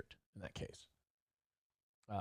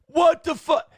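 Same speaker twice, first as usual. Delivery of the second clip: much higher and louder, aghast; shocked outburst; 12 semitones higher, 6 dB louder, slower than usual. Retold or imitated. Hearing the accusation, he blurts out much higher and louder in shock. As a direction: blurt it out in disbelief.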